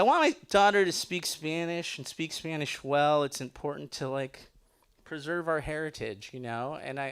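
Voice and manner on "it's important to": ordinary talk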